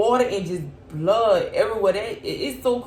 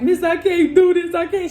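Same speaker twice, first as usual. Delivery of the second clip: high, loud tearful begging; retold crying in labor; crying plea; 9 semitones higher, 6 dB louder than usual. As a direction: cry out that you can't go on. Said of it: "I'm crying".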